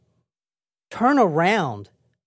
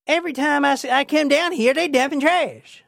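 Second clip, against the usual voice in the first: put-on Southern accent; 8 semitones higher than usual. Truck driver grumbling at trash dumpers, Southern accent. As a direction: gripe in a high Southern accent. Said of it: "It's not the South".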